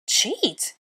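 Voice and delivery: high, sharp outraged yelp. A narrator's reading. Hermione outraged at being called a cheat. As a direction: bounce the word back in shock. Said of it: "I yelped".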